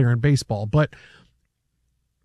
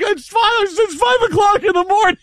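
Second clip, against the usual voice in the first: defensive shout. Retold or imitated. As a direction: shout the excuse back. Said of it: "I'm like".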